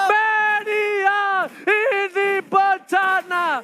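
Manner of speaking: high, loud and drawn-out hype scream; excited screaming. Real, not acted. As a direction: stretch the words out in a frenzy. Caster screams at a game-ending wipe.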